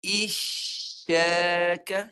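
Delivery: "Isyaka" drawn out very slowly with a clear sh; "Isyaka" slow, with a rising end; with shin. Shown as a demonstration. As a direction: stretch "Isyaka", raising mid-tongue on the sh. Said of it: "Kita tukar dengan shin".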